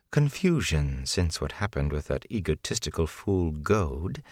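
measured, condescending woman's voice; poised, unhurried menace; coolly menacing villainess. Acted.

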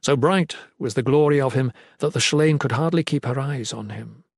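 usual speaking voice

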